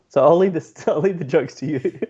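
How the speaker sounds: laughing as you hand it over; amused laugh. Real, not acted. Interviewer conceding to the joke teller, laughing.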